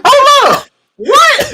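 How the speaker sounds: very high, loud stunned shout; shocked shout. Retold or imitated. Reacting to the outburst, high shocked shout. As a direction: yell in total disbelief. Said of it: "I was like".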